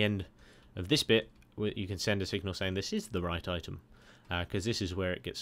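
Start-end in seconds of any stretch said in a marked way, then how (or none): none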